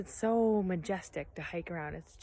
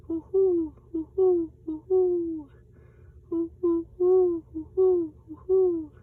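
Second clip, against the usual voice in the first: high loud hooting like an owl; Pa hoots to find owls, high loud owl call; great horned owl call; 9 semitones higher, 7 dB louder, slower than usual. Acted. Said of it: "And then Pa called".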